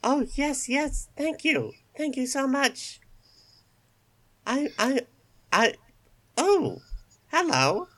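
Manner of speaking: a tiny grateful rabbit squeaking thanks; squeaky bunny voice. Acted. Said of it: "still incredibly cute that you tried".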